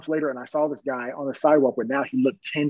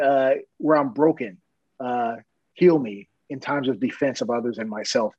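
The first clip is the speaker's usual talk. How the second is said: ordinary talk, nothing marked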